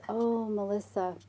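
soft, soothing mother voice; calm a crying child kindly; tender reassurance, slowing at the end; gentle, kind mom. Retold or imitated. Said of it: "very gently and kindly says".

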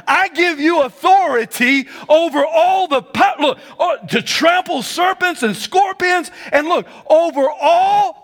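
high, loud shouted pulpit delivery; preacher exhorting the church about authority; full-force preaching. Acted. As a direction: drive home "over all" to the congregation.

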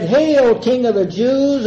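loud, high mock hail; mocking salute. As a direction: cry it out in mock homage. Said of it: "They mocked".

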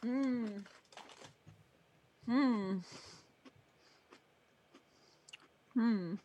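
humming while chewing; mouth full. Real, not acted. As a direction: hum through a mouthful. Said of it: "I'm just chewing".

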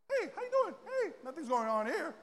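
high, soft sing-song nonchalance; too-casual high-pitched greeting; fake innocent. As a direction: act like nothing is going on, voice way up. Acting innocent while sneaking out.

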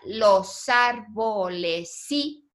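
"los árboles sí" slowly, each syllable drawn out. Shown as a demonstration. Teacher counting the syllables of a line, syllable by syllable. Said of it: "deberíamos de añadirle una sílaba más".

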